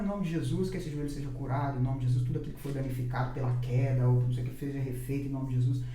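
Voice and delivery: low, measured praying voice; retold prayer. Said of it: "comecei a orar". (oração recontada em voz mais grave)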